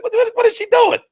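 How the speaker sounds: high, loud startled what-what-what; sudden high-pitched shock at what she saw; shocked disbelief. Retold or imitated. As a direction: blurt it out in shock. Retelling shock at weigh-in.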